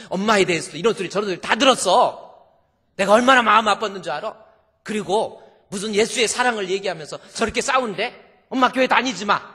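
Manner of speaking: high, loud, fast venting; hurt angry daughter. Acted. Daughter telling mother everything, hurt and loud. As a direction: pour out the hurt in a rush.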